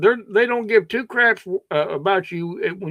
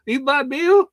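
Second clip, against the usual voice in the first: mock Indian accent; 7 semitones higher than usual. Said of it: "Go back to India".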